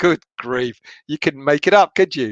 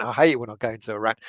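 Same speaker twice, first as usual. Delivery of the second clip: quick aside; 7 semitones lower, 6 dB quieter, faster than usual. Real, not acted. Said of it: "going into a rant".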